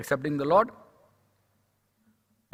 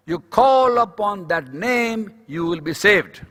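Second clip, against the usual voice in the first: emphatic exhortation; 3 semitones higher, 5 dB louder, much slower than usual. Real, not acted. Preacher urging those with no hope, emphatic exhortation. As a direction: stretch it out and proclaim it.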